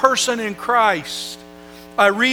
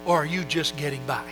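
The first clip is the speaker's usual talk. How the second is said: much softer, leaning in close to the listener; hushed appeal; 5 semitones lower, 11 dB quieter, faster than usual. Real, not acted. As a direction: drop to a near hush to draw them in. Preacher stops to appeal personally.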